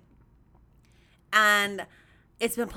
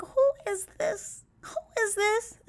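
high, slow, puzzled inner voice; baffled; 11 semitones higher than usual. Retold or imitated. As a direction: wonder who on earth this is. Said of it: "And I'm looking like".

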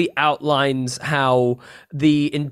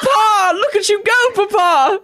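put-on childlike squeal; 4 dB louder than usual. [name] cheering dad as kid.

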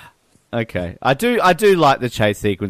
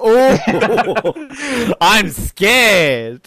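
higher, drawn-out fake fright; mock fear; 9 semitones higher, 3 dB louder, much slower than usual. Host ridiculing a feeble villain, mock scared. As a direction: pretend to be scared, hammed up with laughter. Sarcastically feigning fear of henchman.

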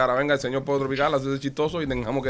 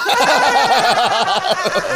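burst of laughter; 8 semitones higher, 6 dB louder than usual. Real, not acted. Laughing at his own story.

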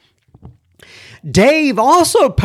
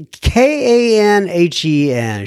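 usual speaking voice

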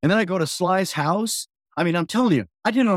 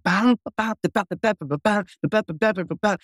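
vocal slap bass riff, a bit higher; scatting slap bass; 3 semitones higher than usual. Retold or imitated. Playing for [name]'s crowd, scatting the riff. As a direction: punch out the bass groove as ba syllables. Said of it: "I just start going".

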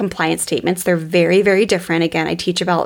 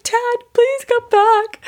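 put-on high, drawn-out little-boy plea; imitated crying child; slower than usual. Crying boy calls his dad back at bedtime, mock tearful. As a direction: plead slowly like a tearful little kid, acted. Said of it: "And so he's crying, crying, crying".

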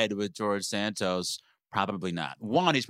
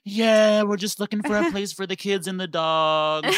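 mock eager homebuyer; 8 semitones higher, 3 dB louder than usual. Mimicking women at open houses.

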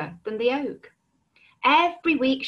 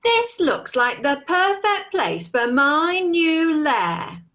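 slow, higher, louder dragon pleased with himself; 7 semitones higher, 4 dB louder, slower than usual. Acted. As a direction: savour every word like a smug dragon. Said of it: "said the dragon".

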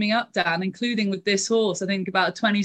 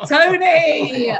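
loud, raised, drawn-out call; exclaiming a name; 6 semitones higher, 6 dB louder than usual. Real, not acted. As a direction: call out the name in mock outrage. Co-host exclaims at Tony in banter.

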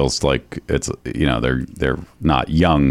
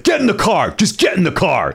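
retold furious yell, pitched way up; angry yelling; 14 semitones higher than usual. Singer yelling at a woman outside their brownstone, angry high-pitched yell. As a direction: imitate a man yelling orders at her. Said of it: "like, just yelling at her".

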